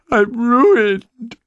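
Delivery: very high, loud, drawn-out wail; broken-hearted sob. A narrator's reading. Farmer breaks down over his uninsured farm, loud sobbing wail. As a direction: howl it out in theatrical despair. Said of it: "he sobbed broken-heartedly".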